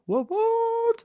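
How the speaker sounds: high squealing hype; excited what what. Pretend. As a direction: yelp it in mock hype. Hyping the YouTube channel.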